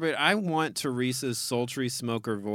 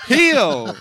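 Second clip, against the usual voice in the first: laughing shout; 10 semitones higher, 9 dB louder than usual. Real, not acted. Laughs at own pun.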